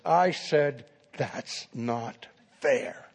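slow, softly spoken mock indignation; angry student. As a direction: repeat the protest slowly, held in and quiet. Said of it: "And now he's angry".

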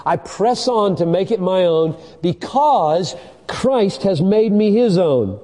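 ringing pulpit declaration of scripture; impassioned preaching. Real, not acted. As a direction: preach it out with swelling conviction. Preaches at full force.

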